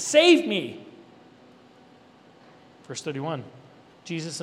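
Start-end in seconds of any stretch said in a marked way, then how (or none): 0.0-0.9 high, loud demand to be saved; commanding cry. Retold or imitated. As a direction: order him to save you. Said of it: "He commands Jesus".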